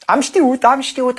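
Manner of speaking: put-on high, thrilled little girl; delighted shout. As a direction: cry out proud she guessed right. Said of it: "a strigat încântată fetița". (fetiță strigând încântată, cu voce subțire)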